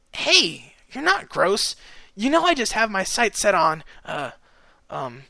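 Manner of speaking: high, quick protest petering out; object, then falter into awkward fillers; protest trailing off. A narrator's reading. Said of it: "before trailing off".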